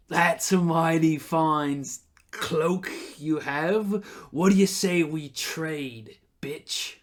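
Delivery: slow, leering menace; sleazy thug. Acted. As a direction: drawl it out like a creep. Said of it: "And he says".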